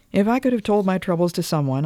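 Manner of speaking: plain reading voice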